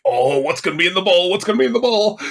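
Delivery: eager suspense. Retold or imitated. Viewer awaiting Art's dinner reveal, mock giddy suspense. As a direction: imitate giddy anticipation, rising as it repeats.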